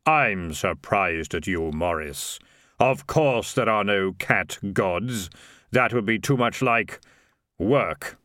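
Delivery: slow, weighty voice; otherworldly authority. Acted. Unearthly figure scolding Morris about cat gods, slow and solemn. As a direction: rebuke him slowly, with solemn authority.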